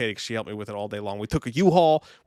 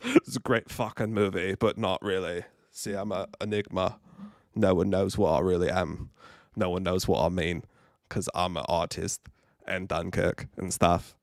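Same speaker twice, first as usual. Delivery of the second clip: Christopher Nolan impression; 4 semitones lower than usual. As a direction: muse like a self-serious auteur. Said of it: "It's Christopher Nolan for the rest of the stream".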